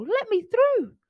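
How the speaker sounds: high, urgent squeak; high-pitched hurry. A narrator's reading. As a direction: squeak it as a desperate plea. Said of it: "squeaked Crocodile".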